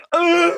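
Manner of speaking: high-pitched wordless yell; mock yell. Costumed characters end the fun night fighting, yelling. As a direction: let out a high fighting scream. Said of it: "it's characters yelling at each other".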